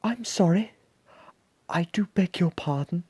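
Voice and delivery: high, polite monster voice; gentle-mannered, high-pitched monster; not at all monstrous. A narrator's reading. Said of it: "in a voice that was not at all monstrous".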